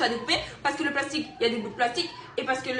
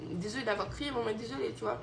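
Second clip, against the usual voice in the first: soft apology; 7 dB quieter than usual. Retold or imitated. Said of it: "j'ai dit".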